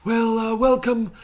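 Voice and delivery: put-on courteous British accent; British surgeon. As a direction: play a refined British brain surgeon. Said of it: "But if a guy can".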